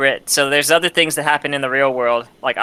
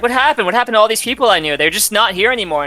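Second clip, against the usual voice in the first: put-on higher, fast, bewildered young voice; higher Roxas voice, shocked; voicing Roxas upset; 6 semitones higher, faster than usual. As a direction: play the confused kid asking where everyone went. Roxas voiced, upset at lost friends.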